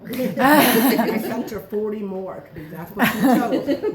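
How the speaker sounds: loud laughing, slow, teasing the host; laughing blame. Real, not acted. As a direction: pin it on her through laughter.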